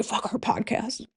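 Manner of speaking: slow, breathy whisper as a ghost. Pretend. Ghosts of the asylum dismissing the podcast, slow whisper. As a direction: play the ghost, hushed and slow. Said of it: "the ghosts of them are like".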